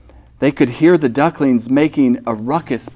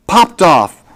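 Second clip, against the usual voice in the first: high, louder burst of excitement; excited reveal; 7 semitones higher, 5 dB louder than usual. Acted. Storyteller revealing the gate popping off, excited. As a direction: pop the words out with excitement. Climax of rescue.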